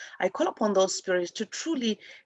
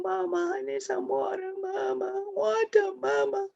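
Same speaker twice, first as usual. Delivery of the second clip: put-on high-pitched, wailing plea of a thirsty child; crying babies; 11 semitones higher, 3 dB quieter than usual. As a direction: imitate a small child crying out for mama. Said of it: "I can imagine babies crying".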